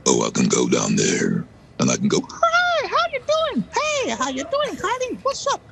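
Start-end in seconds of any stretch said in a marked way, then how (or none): 0.1-1.5 low, weighty bottom of the range. Shown as a demonstration. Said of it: "low or how high you can actually go". 2.3-5.6 squeaky falsetto greeting; tiny cartoon-high chirp. Shown as a demonstration. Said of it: "low or how high you can actually go".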